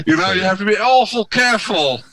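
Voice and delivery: high mock-English accent through laughter; badly faked English accent, pitched high; put-on English accent. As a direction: ham up an English accent, amused. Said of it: "That's better than your South African accent".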